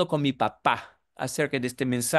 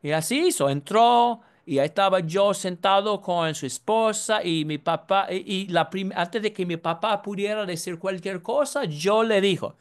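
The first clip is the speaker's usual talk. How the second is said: ordinary delivery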